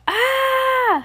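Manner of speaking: high, sustained read-aloud scream; long high "Ah!"; story-time shriek. A narrator's reading. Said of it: "Heidi screamed".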